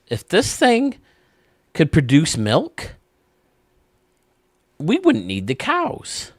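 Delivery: high, slow goofy husband. Acted. Husband grabbing wife's boob, high slow goofy voice. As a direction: stretch it out high and dopey.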